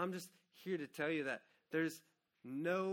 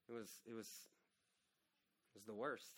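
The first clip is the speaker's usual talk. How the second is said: grief-choked; 6 semitones lower, 11 dB quieter, faster than usual. Real, not acted. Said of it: "hardest time of my life".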